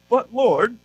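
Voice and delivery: a hint of posh British Gideon; British accent. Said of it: "he has a British accent".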